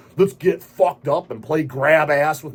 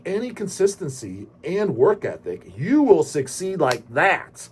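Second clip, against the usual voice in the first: ordinary talk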